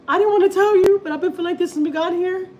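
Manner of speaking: high, loud tearful whine; crying like a baby. Retold or imitated. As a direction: blurt it out as if bawling. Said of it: "I started crying, and it's like a baby".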